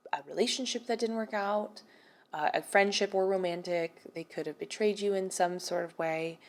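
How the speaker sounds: ordinary talk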